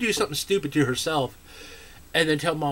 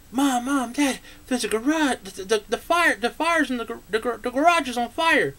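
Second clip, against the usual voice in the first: high, urgent panicked shouting; alarmed kid yelling to mom about the fire; frantic warning; panicked child; 9 semitones higher than usual. Retold or imitated.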